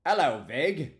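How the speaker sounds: high, booming hello to a newcomer; real shouted-out welcome; loud greeting. Real, not acted. Calling out greeting to a viewer.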